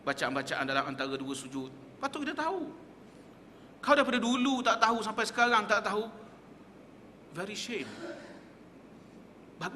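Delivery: ordinary talk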